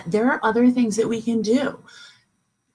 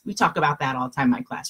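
normal talking voice

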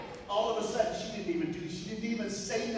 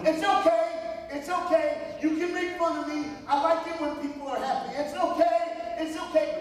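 loud and nervous; 11 semitones higher, 5 dB louder than usual. Retold or imitated. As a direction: blurt it out loud, scared but trying to stay cheerful. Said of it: "he gets loud".

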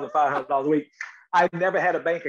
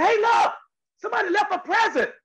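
very high, bright call of discovery; excited call; 4 dB louder than usual. Retold or imitated. Man calling to his friends about a found gift, high and excited. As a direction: shout it up high like a kid finding treasure.

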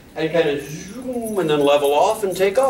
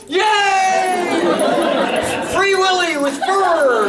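cheering crowd; 12 semitones higher, much slower than usual. Retold or imitated. Neighbors cheering the dog leaping at a bird, high excited whoop. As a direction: whoop like a crowd watching a stunt.

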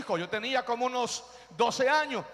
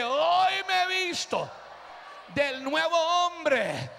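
higher, drawn-out preacher's shout; shouted declaration; 3 semitones higher, much slower than usual. Real, not acted. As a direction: cry it out and hold the words.

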